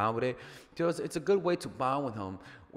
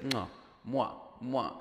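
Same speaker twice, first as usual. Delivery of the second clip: soft kiss sounds; 5 dB quieter, slower than usual. Shown as a demonstration. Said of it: "slight kisses".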